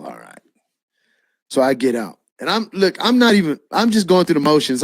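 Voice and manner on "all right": very low, barely voiced grumble; retold grudging sigh of assent; reluctant giving in. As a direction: give in under your breath. Reluctantly gives in to the lady.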